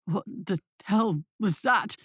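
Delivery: slow, shaken stammer; a frightened man stumbling out his words; mild shaken stammer. A narrator's reading. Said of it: "stammered Denton".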